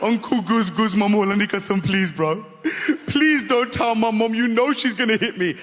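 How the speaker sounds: put-on high, whiny pleading kid; begging nephew. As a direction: beg your uncle not to tell.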